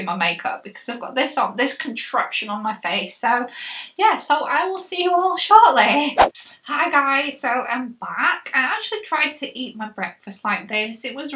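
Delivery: jaw held up by strap; slightly restricted jaw movement; wearing a chin strap. Real, not acted.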